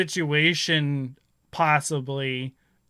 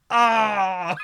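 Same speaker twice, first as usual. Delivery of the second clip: high, loud comic wail; hammed-up anguished "aah"; mock scream; 7 semitones higher, 5 dB louder than usual. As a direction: cry out in pretend horror. Acting out the characters' horrified cry.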